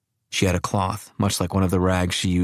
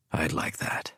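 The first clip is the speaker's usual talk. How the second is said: much softer, almost whispered; 3 semitones lower, 9 dB quieter, slower than usual. A narrator's reading. Old man accepting his carer's offer, softly in the dark. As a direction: let it fall soft into the dark. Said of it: "His voice was soft in the dark".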